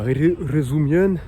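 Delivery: careful learner's "rozumiem"; slow careful Polish. Shown as a demonstration. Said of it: "il faut dire".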